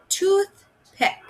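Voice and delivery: toothpick drawn out slowly and high; teacher slowly modelling toothpick for young readers; slow stretched word. Shown as a demonstration. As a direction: stretch toothpick out so kids hear each part. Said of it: "Our next picture is toothpick".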